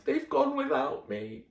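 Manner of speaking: mild crying. Acted. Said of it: "And he cried".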